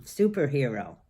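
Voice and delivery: usual speaking voice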